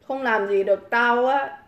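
ordinary delivery

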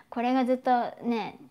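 ordinary talk, nothing marked

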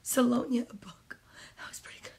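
breathy whisper, nearly no voice. Shown as a demonstration. Reader whispering the book title to the kids, whisper. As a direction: whisper Salonia, a book, under your breath. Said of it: "whispered name".

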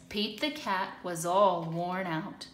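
usual speaking voice

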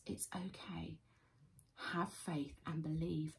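soft whispered comfort; calm him quietly, slowly; tender hushed reassurance to the father; gentle reassurance. Retold or imitated. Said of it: "Jesus says".

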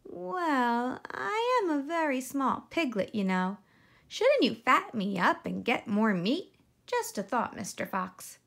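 high, coaxing little pig voice; sweet piglet making a suggestion; crafty piglet. Acted. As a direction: talk the fox out of eating you. Piglet voice in story.

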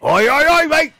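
loud, high mock British holler; terrible British accent. Capping the bit for co-host, loud fake British accent. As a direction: holler it like a cartoon Brit. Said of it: "It's a terrible British accent".

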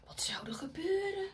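hushed suspense whisper; breathless storyteller whisper. Shown as a demonstration. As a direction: whisper it to build suspense for the kids. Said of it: "unvoiced suspense question".

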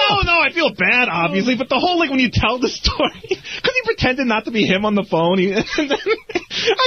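high-pitched, breaking into laughter; laughing protest. Real, not acted. Guilty staffer defending himself for telling the story, laughing. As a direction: protest while helplessly giggling.

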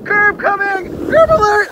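high loud alarmed call; warning shout. Real, not acted. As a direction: yell a warning. Curb approaching on sled.

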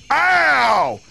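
elephant's startled ow; Trunky's deep voice. A narrator's reading. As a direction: cry out as the elephant who just got bitten. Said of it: "said Trunky in his big, deep voice".

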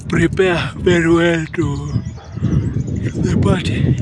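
talking through a yawn. Real, not acted. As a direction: stretch the words out in a yawn. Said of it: "I'm yawning".

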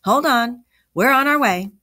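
high, loud call across a distance; calling back. A narrator's reading. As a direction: call out to someone lost. Calling back to lost man.

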